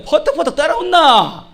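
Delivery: high, stretched Gyeongsang dialect call. Acted. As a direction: holler for the flock in Gyeongsang accent. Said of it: "경상도에서 맨날". (높고 길게 뽑는 경상도 사투리)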